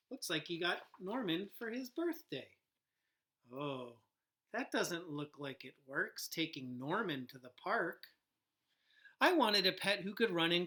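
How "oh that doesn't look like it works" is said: ordinary delivery, nothing marked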